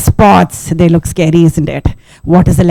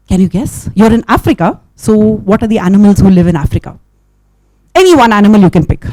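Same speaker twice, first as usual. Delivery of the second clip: plain reading voice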